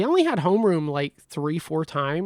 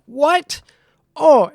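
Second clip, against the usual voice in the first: surprised shout; 10 semitones higher, 11 dB louder than usual. Retold or imitated. Corey shocked, asks what.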